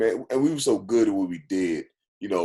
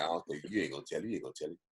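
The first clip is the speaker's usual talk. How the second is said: fast, softer taunt; quick quiet doubting; 4 semitones lower, 11 dB quieter, much faster than usual. Retold or imitated. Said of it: "And we said".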